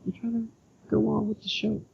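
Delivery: squeaky defensive denial; high-pitched protest. Real, not acted.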